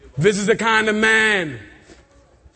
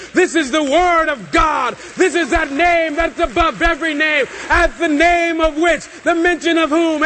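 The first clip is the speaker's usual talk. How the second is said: higher-pitched, ringing declaration; full-force preaching; 4 semitones higher than usual. Acted. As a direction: build it as a proclamation.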